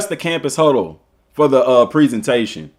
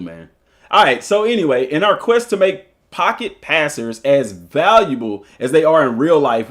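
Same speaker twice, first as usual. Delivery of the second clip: normal talking voice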